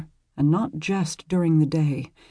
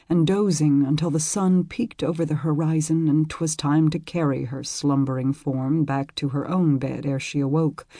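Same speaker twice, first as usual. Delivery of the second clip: usual speaking voice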